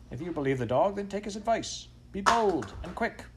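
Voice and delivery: high-pitched, insistent wife; scolding wife. A narrator's reading. Wife urging farmer to heed dog, insistent. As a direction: push him to act, voice climbing high. Wife urges husband to act.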